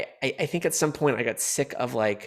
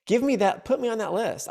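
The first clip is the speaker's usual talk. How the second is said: mock eager; 10 semitones higher than usual.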